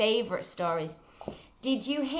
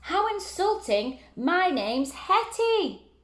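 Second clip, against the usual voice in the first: much higher, slow huffy monster voice; offended monster; 9 semitones higher, slower than usual. Acted. Monster Hetty correcting George, high slow huffy voice. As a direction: play the insulted monster Hetty.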